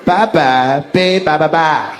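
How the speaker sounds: "bye-bye", pause, then "babe, bye-bye-bye" in measured beats; cadence demo. Shown as a demonstration. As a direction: tap out "bye-bye, babe, bye-bye-bye" as a slow rhythm. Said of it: "that's the cadence".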